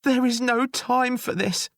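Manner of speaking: high, sobbing protest. Acted. Jailer sobbing at the grate. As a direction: sob it out high. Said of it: "sobbed the jailer".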